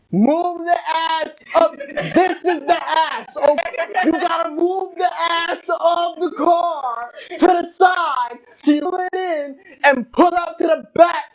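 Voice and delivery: high, slow, emphatic hollering; imitating screaming dad. Dad repeating driving instructions to Xavier, screaming. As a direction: stretch out each instruction at a yell. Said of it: "He was literally screaming".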